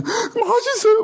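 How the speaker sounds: hoch und langsam, halb weinerlich gespielt; weinender Schüler nachgeahmt. Retold or imitated. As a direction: spiel den Schüler, der beim Weinen schwärmt. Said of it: "막 이러면서 울면서".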